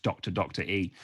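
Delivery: "Doctor E", with [name] read as "Doctor E"; childhood mispronunciation. Retold or imitated. Recalling a twelve-year-old's misreading, mispronounced "Doctor E". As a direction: say "Doctor E" as two titles misread. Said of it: "I thought it was pronounced".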